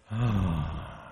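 long, heavy sigh of sorrow; sighing over great horror and sadness; audible sigh. Acted. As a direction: breathe out a deep sigh.

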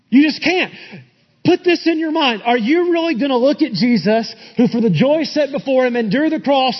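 high, driving pulpit exhortation; impassioned sermon rebuke; forceful preaching. Real, not acted. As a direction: challenge the congregation hard, pitch riding high. Preaches a warning against laziness.

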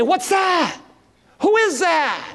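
high, startled mock fright; a hint of panicked fishermen in a storm; frightened disciples. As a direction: call out as if you've seen a ghost. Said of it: "And they're really frightened".

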